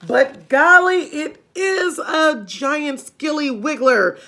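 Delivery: loud, high put-on voice for a nasty old man, slowing on the last words; husband warns his wife about the frog in a loud put-on voice; Mister Twit. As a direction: boom it out like a grumpy old husband. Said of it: "Mister Twit said".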